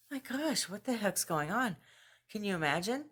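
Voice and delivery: high-pitched retold disbelief; retold shock. Retold shocked reaction to Brad.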